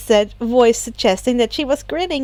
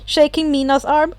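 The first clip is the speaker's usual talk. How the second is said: ordinary delivery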